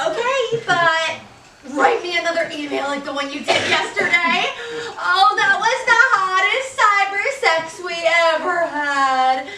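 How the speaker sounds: high giggling through the words; giggly. Acted. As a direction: giggle it out breathlessly. Giggling mistress recalls cyber sex.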